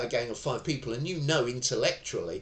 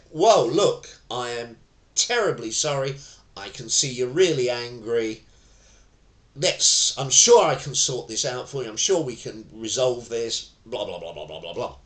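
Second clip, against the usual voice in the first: placating de-escalation; 8 dB louder than usual. Shown as a demonstration. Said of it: "It is perfectly okay to say".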